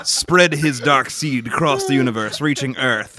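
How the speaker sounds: solemn booming legend voice; grave ancient myth telling. Pretend. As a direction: tell it like an ancient prophecy. Mock creation myth narration.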